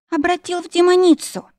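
high, wailing moan; moaning in pain. A narrator's reading. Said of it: "простонала я". (протяжно стонет от боли)

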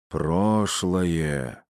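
sepulchral trance voice, drawn out; загробный голос. Acted. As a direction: intone it like a seance medium. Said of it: "за могильным голосом произнесла". (загробным голосом прорицательницы)

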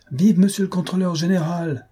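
high, ringing cheer; crowd's cheer. A narrator's reading. Crowd cheering the controller general, ringing cheer. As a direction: hail him like a cheering crowd. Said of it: "s'écria la foule".

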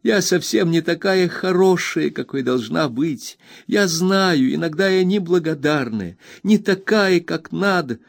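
put-on high, tearful girl's voice; Emily sobbing to Ham; sobbing plea. A narrator's reading. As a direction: cry it out, voice climbing high. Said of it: "продолжая горько рыдать".

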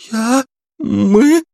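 high, stammering woman's voice; a frightened squeak; startled receptionist. A narrator's reading. Receptionist startled at gunpoint, frightened squeak.

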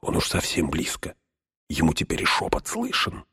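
breathy hushed hobbit; barely voiced, close and low; whisper. Frodo whispers near Gollum.

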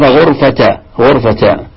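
غرفة as "ghurfata"; fatha on ta, no tanween. Shown as a demonstration. Teacher confirming he understood, open unnunated ta on غرفة, "ghurfata". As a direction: end غرفة in open -ta without n. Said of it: "التاء مفتوحة وليست منونة".

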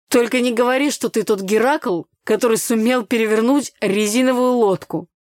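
loud, incredulous ribbing; teasing disbelief. A narrator's reading. Neighbour teasing soaked girl about the boat, incredulous. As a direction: rib the neighbour with mock amazement.

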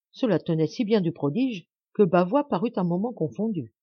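ordinary delivery

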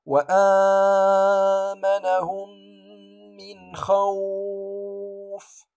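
slow recited خوف with the و held long; Quran recitation. Shown as a demonstration. Teacher reciting خوف for students, stretched long. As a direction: chant "من خوف" stretching the vowel four counts. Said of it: "لكن أقول".

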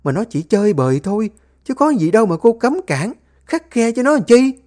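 shrill-voiced rebuke of the family squabble; scolding mother-in-law. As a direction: tear into them like a furious mother-in-law. Mother-in-law defending son.

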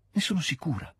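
"Ne sono sicura" said high but soft, the cry still held in; rising certainty. Acted. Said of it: "gridò quasi Natascia". ("Ne sono sicura" detto acuto ma sommesso, trattenuto)

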